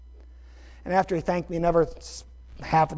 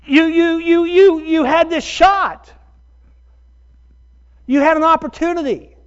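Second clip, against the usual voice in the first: high, loud, slow stammering rebuke; raised pitch, emphatic reproach; self-scolding; 10 semitones higher, 6 dB louder than usual. Retold or imitated. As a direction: hammer yourself with each you. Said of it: "I'm like".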